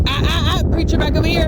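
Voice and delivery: high-pitched call to a straying dog; correcting a dog. Real, not acted. Calling a straying dog back.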